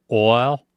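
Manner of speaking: demonstrating how to say Oil. Shown as a demonstration. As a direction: sound out Oil carefully. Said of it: "how people pronounce oil".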